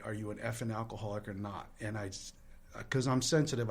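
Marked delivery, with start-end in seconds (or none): none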